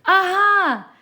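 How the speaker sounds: very high, loud triumphant cry; excited shout. A narrator's reading. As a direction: leap up and shout it. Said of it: "she shouted with a jump".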